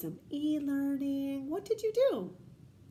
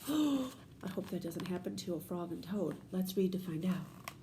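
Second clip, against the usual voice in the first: normal talking voice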